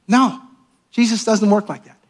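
ordinary talk

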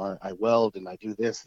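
normal talking voice

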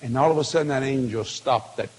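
ordinary talk

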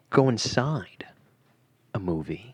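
hushed spooky whisper. Acted. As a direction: whisper it eerily.

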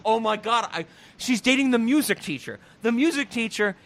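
ordinary talk

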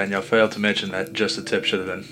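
ordinary talk, nothing marked

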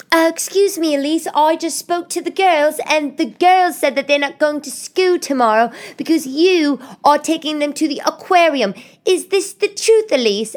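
high, loud, put-on nagging ex; ex-husband's voice. Ex-husband questioning Elise about the aquarium, mocking high voice. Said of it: "let me do his voice".

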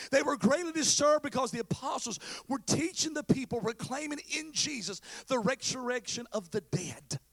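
impassioned scripture delivery; preached scripture reading. Real, not acted. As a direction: declaim the verse from the pulpit.

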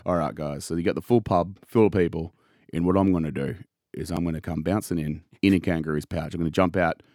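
low, soft mock pitch to the room; movie pitch. Hogan pitching his kangaroo entrance to colleagues, low mock pitch. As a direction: lay it out low and understated. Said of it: "His original pitch was".

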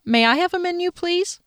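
overly sweet ask to a rude server; sweetly polite. Acted. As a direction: kill her with kindness. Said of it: "I smiled sweetly at her".

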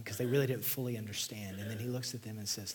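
ordinary delivery

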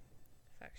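soft whispered aside; whispering. Real, not acted. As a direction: breathe it, no voice. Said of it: "Whispering off".

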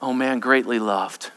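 soft, low and gentle; tender reassurance. Acted. Angel gently answers the devil's lie, soft and low. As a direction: answer the lie with quiet love.

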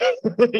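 short high, loud laugh. Real, not acted. Member laughing at his own confession, laughing. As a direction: burst out laughing at yourself.

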